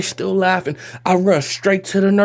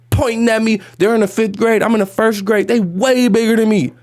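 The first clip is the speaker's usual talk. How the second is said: usual speaking voice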